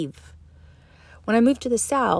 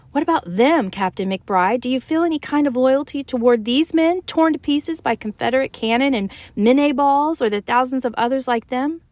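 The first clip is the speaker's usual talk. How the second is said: confronting; 5 semitones higher, 5 dB louder than usual. Acted. Caroline confronts McBride.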